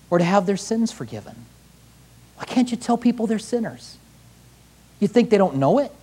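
ordinary talk

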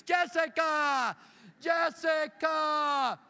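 very slow, high, loud shouted name; drawn-out retold scream. Strangers at Disneyland bellowing the lost girl's name. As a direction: stretch the name into a long shout across the crowd. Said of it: "And they start screaming".

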